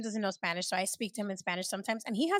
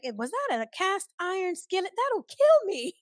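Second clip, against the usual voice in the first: high-pitched, rising mock panic; mock alarm; 10 semitones higher, 4 dB louder than usual. Acting out dodging a thrown skillet, mock alarm. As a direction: squeak it out in comic disbelief.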